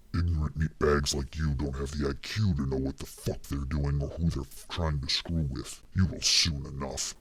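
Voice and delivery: very low growling demon voice; predator growl. A narrator's reading. Damien threatens the cornered intruder in a low predator growl. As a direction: growl it from deep in the throat like a big predator. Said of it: "It was now a growl".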